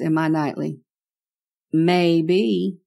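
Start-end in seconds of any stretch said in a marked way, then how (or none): 1.7-2.8 teasing, long drawn-out maybe. A narrator's reading. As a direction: stretch the word out slowly. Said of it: "He draws the word out real long".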